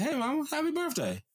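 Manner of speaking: put-on high, little-kid voice greeting mama; toddler voice. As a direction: imitate a toddler proudly saying it. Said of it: "she said".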